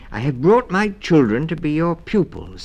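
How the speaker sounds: put-on a mother mouse politely presenting her children; soft-spoken mother mouse. A narrator's reading. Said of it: "said Missus Mouse softly".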